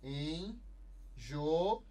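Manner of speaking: "Enjoar" split into en, jo, ar with long pauses; "Enjoar" pulled apart slowly for a class; syllable by syllable. Shown as a demonstration. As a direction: break "Enjoar" into three separate, drawn-out syllables. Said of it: "Separando a sílaba".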